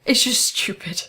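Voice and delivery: unvoiced whisper of the same line. Real, not acted. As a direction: breathe it as a fond whisper. Repeats line, second whispered.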